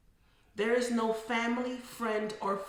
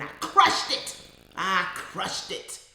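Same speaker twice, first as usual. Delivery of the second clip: loud, forceful boast easing into slow emphasis; fierce triumph; 7 dB louder, slower than usual. Real, not acted. Therapist celebrating passing the universe's test, forceful triumph. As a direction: punch it out in triumph.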